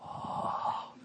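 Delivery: breathless little whispered oh of wonder; a kid's hushed gasp of delight; awed whispered oh. Retold or imitated. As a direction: son waking to good news, whispered oh. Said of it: "And he just goes".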